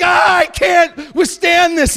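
high, loud, slow anguished cry; defeated sin. Retold or imitated. Sin overwhelmed by Christ, in a loud high anguished wail. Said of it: "he's like".

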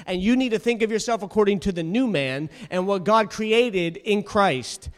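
ordinary delivery

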